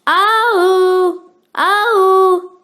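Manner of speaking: long loud mooing cow sound; cow lowing. A narrator's reading. Cow moos out of the silence, loud and drawn out. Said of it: "a long, strong sound".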